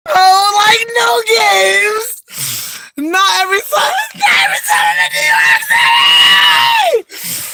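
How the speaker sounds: high, loud shrieking laugh; screaming with laughter. Real, not acted. Laughing at her own joke to viewers, shrieking high and loud. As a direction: lose it completely.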